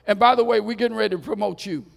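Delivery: loud, then rushing the promise fast; king's decree. Acted. King tells the three men of their promotion, loud and quick. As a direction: bark the aside, then hurry through.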